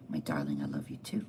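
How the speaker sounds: low, soft, tender reply; loving. Retold or imitated. Esther answers her husband the king, low and loving. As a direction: drop the voice into a quiet endearment.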